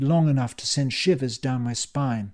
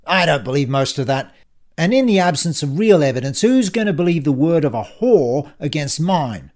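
loud, contemptuous bully; sneering villain; 7 dB louder than usual. Acted. Ian dismisses Dan's peace proposal, loud and sneering. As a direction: sneer it out forcefully.

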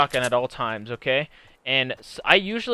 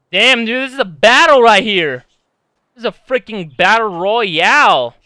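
much louder, higher hyped outburst; excited; 5 semitones higher, 10 dB louder than usual. Real, not acted. Gamer hyped during a close fight, loud excitement. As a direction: get pumped by the fight.

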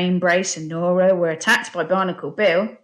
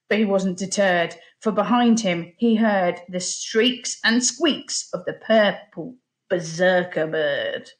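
ordinary delivery

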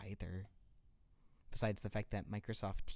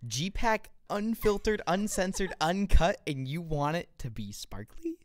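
high, loud, punched-out list; hammed-up incredulous rant; mock outrage; 8 semitones higher, 8 dB louder, slower than usual.

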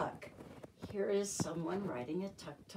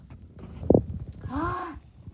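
excited gasp; 11 semitones higher, 8 dB louder than usual. Acted. Excited gasp before next verse.

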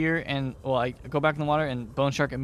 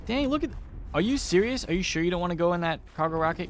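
surprised; 4 semitones higher, 3 dB louder than usual. Real, not acted. Reacting to rocket launch.

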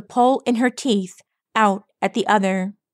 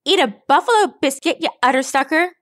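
high, quick battle taunt; feisty taunt; 6 semitones higher, 3 dB louder, much faster than usual. A narrator's reading. Rainbow Dash drop-kicking Thunderhorn, feisty taunt. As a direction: hurl it as a cocky insult.

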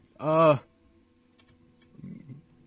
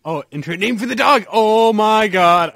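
excited; 4 semitones higher, 13 dB louder than usual. Real, not acted. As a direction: burst out with delight. Gamer thrilled by dog naming.

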